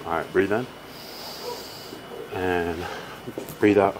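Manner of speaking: low, soft coaching voice for breathing; low soothing stretch coach; calm breathing cues. Acted. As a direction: guide the client through each breath, softly.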